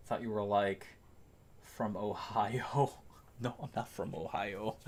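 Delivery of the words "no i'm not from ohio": hushed, offhand denial; quiet denial. Real, not acted. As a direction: say it much softer, almost to yourself. Plain denial before mock-outraged repeat.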